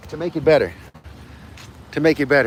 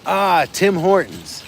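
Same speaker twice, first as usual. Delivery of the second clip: loud, slow, higher delight; 7 semitones higher, 6 dB louder, slower than usual. Real, not acted. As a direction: savor the name with joy. Delighted at Tim Hortons.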